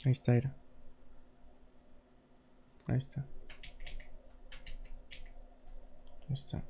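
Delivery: very low, hushed repeat, fading out; fading away. Real, not acted. Streamer showing where it is, fading away. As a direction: let each repeat sink almost to nothing.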